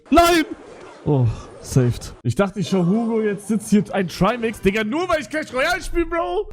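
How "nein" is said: loud, high cry of no; sudden loud dismayed no; shocked shout. Real, not acted. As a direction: yell it in dismay. Reacting to game loss.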